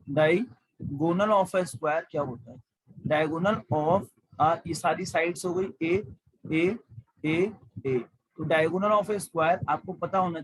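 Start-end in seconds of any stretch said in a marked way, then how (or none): 0.1-4.1 slow, stretched out; dictation pace. Shown as a demonstration. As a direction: stretch the words for copying. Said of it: "formula क्या होता है". (धीरे धीरे बोलना)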